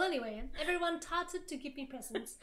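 plain reading voice

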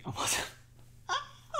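a high breathless sob-laugh; high crying laugh. Real, not acted. Hearing partner say yes, a high tearful laugh.

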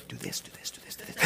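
whispering the fix under his breath; hushed whisper, half joking; sneaky whisper. Retold or imitated. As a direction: slip it in as a secret whisper. Still fixing while hugging her.

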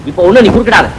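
normal talking voice

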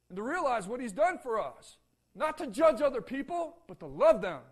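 loud, high-pitched preacher's appeal; fervent pulpit exhortation; preaching at full force. Acted. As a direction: drive the question home to the pews.

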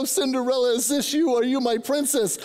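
lightly voiced prince, pitched high; playful prince. Grandpa kneeling to his granddaughter with the slipper, playful prince. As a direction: ask it high, playing the storybook prince.

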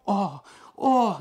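high, loud anguished cry. A narrator's reading. Losar crying out on learning the chute was emptied, anguished. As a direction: cry out in despair. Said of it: "закричал Лосар".

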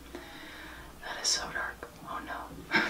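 a whispered oh no. Real, not acted. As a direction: whisper it in dismay. Quiet dismay over makeup mistake.

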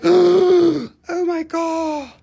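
very high, loud, slow drawn-out awe; mock shock. Host mocking the yikes comments, hammed-up shock. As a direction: play up the viewers' horror. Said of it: "two comments are like".

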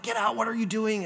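soft mimicked stage whisper; imitated secret urging. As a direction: warn a classmate without the teacher hearing. Pupils secretly hissing at him, retold.

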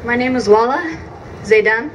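usual speaking voice

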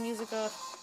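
ordinary delivery

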